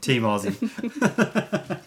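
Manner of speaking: real laugh spilling into the words; laughing cheer. Real, not acted. Co-host cheers a fellow Australian, laughing.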